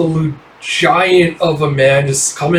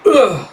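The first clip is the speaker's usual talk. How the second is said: much higher grunt; 8 semitones higher than usual. Acted. The giant grappling, high grunt. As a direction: strain as you strike.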